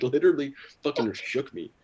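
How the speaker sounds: normal talking voice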